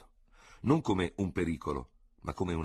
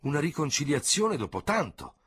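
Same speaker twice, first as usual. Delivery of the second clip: higher, lively cry of surprise; surprised exclamation; 10 semitones higher, 3 dB louder than usual. A narrator's reading. The two seconds exclaiming at the news, surprised. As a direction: exclaim together in glad surprise. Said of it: "esclamarono insieme".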